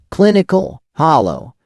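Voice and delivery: usual speaking voice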